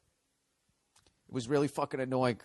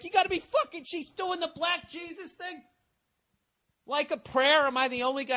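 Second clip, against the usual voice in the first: disbelief. Retold or imitated. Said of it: "at first I'm like".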